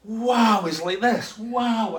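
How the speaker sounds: loud, high wows of wonder; eyes just opened on something astonishing; gasping awe. Retold or imitated. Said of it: "he gasped. He went".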